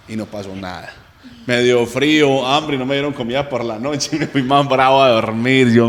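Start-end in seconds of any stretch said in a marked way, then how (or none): none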